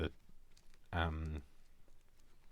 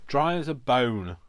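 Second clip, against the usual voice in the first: exclaiming; 6 semitones higher, 13 dB louder, slower than usual. Real, not acted. Testing ink pad, exclaiming.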